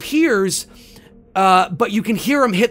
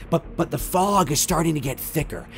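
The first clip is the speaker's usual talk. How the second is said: usual speaking voice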